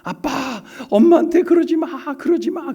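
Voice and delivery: little daughter begging her father through tears; crying child. Retold or imitated. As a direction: beg daddy to stop, sobbing like a little girl. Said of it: "울면서".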